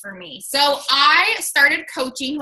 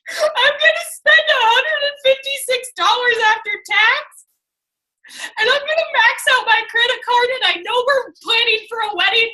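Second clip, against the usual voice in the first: high, loud tearful pleading; reliving the tearful decision; crying plea; 9 semitones higher, 3 dB louder than usual. Retold or imitated. Retelling her tearful plea.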